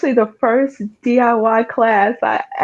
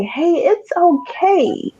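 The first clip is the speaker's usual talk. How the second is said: higher, slow and gentle; self-reassurance; 6 semitones higher, slower than usual. Retold or imitated. [name] retelling how she comforted herself, gently. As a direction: coax yourself kindly. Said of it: "dig deep within myself and say".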